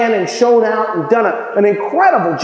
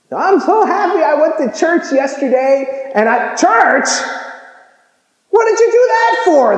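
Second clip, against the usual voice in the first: mock scream; 9 semitones higher than usual. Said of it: "they scream at the water cooler".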